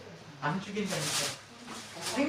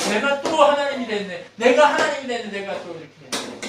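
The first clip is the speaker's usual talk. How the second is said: chest-beating lament; 6 semitones higher, 9 dB louder than usual. Acted. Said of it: "가슴을 치면서".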